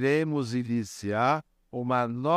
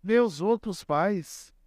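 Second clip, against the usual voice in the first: mild imitated crying; 6 semitones higher, slower than usual. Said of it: "tudo isso já chorando".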